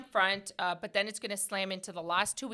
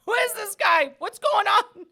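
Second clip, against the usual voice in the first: much higher, louder mock bewilderment; baffled; 11 semitones higher, 8 dB louder, slower than usual. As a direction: act baffled at the stranger. Baffled self quoted.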